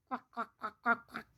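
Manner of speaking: soft, rapid duck quacks; string of small hushed quacks; duck quacking. Shown as a demonstration. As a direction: imitate a duck in short quiet bursts. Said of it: "make the sound of a particular animal".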